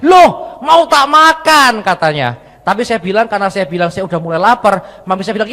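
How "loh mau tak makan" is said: high, loud, drawn-out shout; mother's yell. Retold or imitated. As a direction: shout it as an indignant mom. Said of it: "Lalu mami saya teriak".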